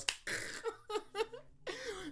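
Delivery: long high-pitched laugh. Real, not acted. Laughing at gore scene.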